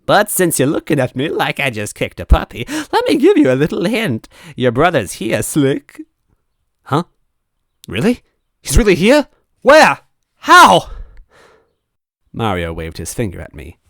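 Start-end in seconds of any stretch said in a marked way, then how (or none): 0.0-6.0 high, loud, warped villain voice; distorted ghost Mario. Acted. Ghost Mario teasing the narrator, high and distorted. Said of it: "his voice distorted and wrong". 6.8-10.9 rising higher and louder with each question; desperate, breathless hope; frantic excitement. Acted. Narrator hearing his brother is here, escalating excitement.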